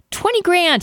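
pleading, voice jumps up; desperate. Acted. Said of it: "he says in desperation".

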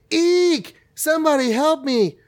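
put-on high squeaky kid yelling for help; child cry for help. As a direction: squeal like a scared little boy. Boy Billy cries for help.

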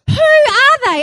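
loud, high-pitched indignant echo; hammed-up disbelieving shout; incredulous outrage. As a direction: throw the question back, scandalised. Acted outrage, echoing husband's question.

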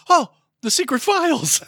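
high-pitched "oh" of sudden discovery; hammed-up surprise at a find; mock discovery. Pastor stumbles on church records while driving, high mock surprise. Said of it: "he just happens to go".

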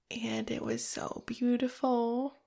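barely holding it together; real choked-up tenderness; tearful. Real, not acted. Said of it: "As you can tell, I'm still very emotional".

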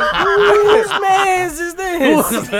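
high, slow, laughing mock challenge; mock tough challenge. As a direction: squeal it out like a startled girl. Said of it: "I'm like".

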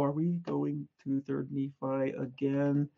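quiet, slow, whiny "Are we going to Third Nephi again?"; mock weary groan. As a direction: mimic a bored student complaining. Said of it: "I know at least half of you go".

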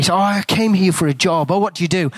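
ordinary talk, nothing marked

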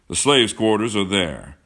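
a hint of a lordly host; proud Braddock Washington. A narrator's reading. Washington shows John the grounds, loud and assured.

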